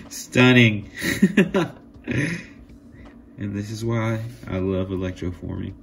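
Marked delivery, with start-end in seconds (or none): 0.0-1.7 a high, loud, laughing stunning; delighted laugh. Real, not acted. Thrilled laugh at the finished accents. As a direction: laugh with joy around the word.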